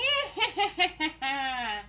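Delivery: high cackling witch laugh; witch cackle. Acted. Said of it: "that witch cackle was actually pretty good".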